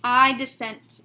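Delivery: loud, ringing declaration; forceful judicial pronouncement; emphatic dissent. Retold or imitated. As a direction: proclaim it to the whole courtroom. Quoting the justice's famous dissent.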